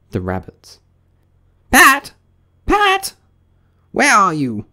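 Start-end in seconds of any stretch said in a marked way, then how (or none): 1.7-4.7 very high, loud, angry calling; angry rabbit. A narrator's reading. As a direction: shout for the servant, piercingly high. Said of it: "Next came an angry voice".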